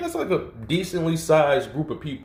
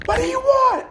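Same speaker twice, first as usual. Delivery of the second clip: very high, louder screamed repeat of "What do you want?"; mock scream; 5 dB louder than usual. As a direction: push the second "want" up into a full shriek. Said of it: "he's just screaming".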